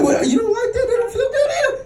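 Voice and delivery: high-pitched confused question; baffled. Retold or imitated. Acting his baffled reply mid-argument.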